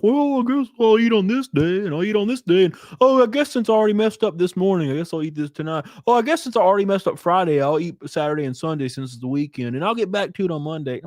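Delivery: high, whiny mock excuse voice; mocking whiny dieter. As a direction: sneer through the weak excuses. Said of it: "I'm not gonna be like".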